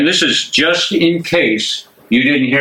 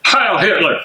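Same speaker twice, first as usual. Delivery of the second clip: a higher, forceful Heil Hitler; barked salute; 6 semitones higher than usual. Acted. Major salutes the prisoners with a barked Heil Hitler. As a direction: bark the salute like an order.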